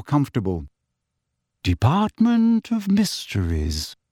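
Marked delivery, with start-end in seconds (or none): 1.6-4.0 higher, slow, detached announcement; measured lift announcer; cool female voice. A narrator's reading. Lift voice announcing the floor, cool and slow. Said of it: "said the cool female voice".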